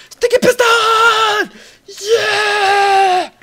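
very high, drawn-out celebratory yell; ecstatic shout. Real, not acted. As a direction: scream it out long and loud. Celebrates making sticky piston.

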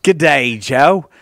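loud, put-on Australian greeting; Australian accent. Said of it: "That very convincing Australian accent was actually you".

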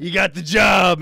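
slow, loud announcement; loud, drawn-out mock proclamation; mock booming boss. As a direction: shout it out as the hiring boss. Retold boss hiring on the spot.

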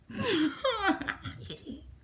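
long, high-pitched giggle; high laugh. Real, not acted. Vlogger laughing at her own smacking, high laugh.

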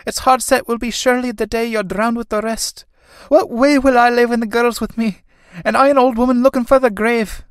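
put-on high, loud, lamenting old woman; distressed old mother. Old mother pleads with departing son, high lament.

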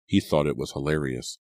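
ordinary delivery, nothing marked

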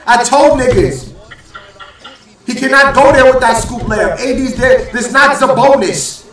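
real hype at the TV; excited yelling. Real, not acted. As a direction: celebrate like the block just won it. Fan celebrating the block loudly.

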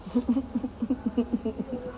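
soft, high-pitched run of giggles. Real, not acted. After mocking a woman as pretending pretty, high giggle. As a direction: giggle lightly, high and quick.

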